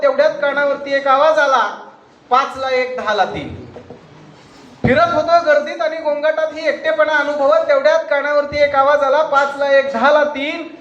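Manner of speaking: loud, high, declaiming a poem; poem recitation. Acted. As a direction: recite to the hall with feeling. (कविता सादर करताना उंच भावपूर्ण आवाज)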